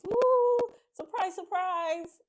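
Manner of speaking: high, loud, drawn-out cheer; excited surprise. Real, not acted. Host revealing a surprise to viewers, gleeful cheer. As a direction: announce the surprise with glee. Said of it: "so here's a surprise".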